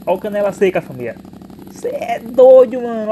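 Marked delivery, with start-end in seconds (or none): 1.7-3.1 loud, high and very slow hype shout; excited drawn-out shout. Real, not acted. Motovlogger hyping his new exhaust to viewers, drawn-out excited shout. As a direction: stretch it out, hyped about the exhaust.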